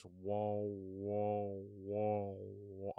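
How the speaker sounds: drawn-out droning "whoa"; imitated muffled talk. Climber hears the guy's talk as noise, imitated drone. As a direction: imitate speech turning into long, blurred noise. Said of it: "all I'm hearing is".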